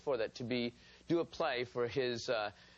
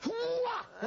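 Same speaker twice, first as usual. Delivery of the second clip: startled shriek. Retold or imitated. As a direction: yelp like you've been caught. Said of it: "So I went".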